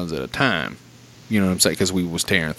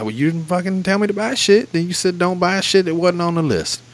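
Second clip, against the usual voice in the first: exasperated retort; 8 semitones higher than usual. Retold or imitated. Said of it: "I said".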